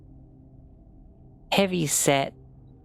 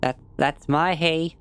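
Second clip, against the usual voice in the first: put-on southern accent; 3 semitones higher, 3 dB louder than usual. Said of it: "I keep slipping into that fucking accent".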